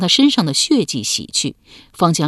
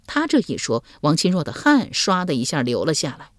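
ordinary delivery, nothing marked

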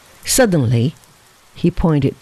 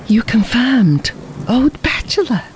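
a mock-outraged exclamation; exclaiming, higher; 6 semitones higher than usual. A narrator's reading. Fabian ribbing Teco about bachelorhood, mock outrage. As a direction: blurt it out, pitched up. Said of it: "ejaculated Fabian".

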